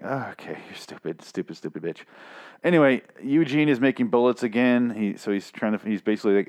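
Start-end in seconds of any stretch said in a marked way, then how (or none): 0.0-2.0 much softer, grumbling under the breath; quiet sulky mutter; muttered insult. Retold or imitated. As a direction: mutter it like you don't want her to hear. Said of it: "I'm like".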